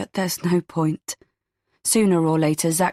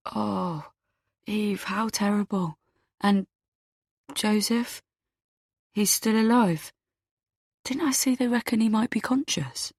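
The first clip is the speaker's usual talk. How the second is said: soft, higher-pitched concerned man; gentle sympathy; 4 semitones higher, 4 dB quieter than usual. A narrator's reading. Man hears his date's terrible past, gently sympathetic. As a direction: speak softly, shocked and sympathetic. Zach sympathises after her confession.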